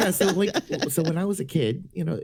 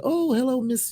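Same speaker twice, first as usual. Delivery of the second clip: high, perky sales greeting; chirpy telemarketer; 12 semitones higher than usual. Retold or imitated. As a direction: lift the voice like a cheerful cold caller. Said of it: "the telemarketer's like".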